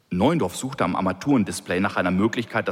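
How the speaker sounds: ordinary delivery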